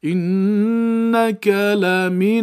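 drawn-out recited انک لمن, each syllable stretched; very slow, careful recitation; slow Quranic recitation. Shown as a demonstration. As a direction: chant it slowly like a student reciting. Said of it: "آفرین".

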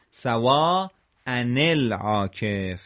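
slow سوا ان العاکف as "sawaa'a-NEL-aakef"; syllable by syllable, sounding "nel"; joined tanween. Shown as a demonstration.

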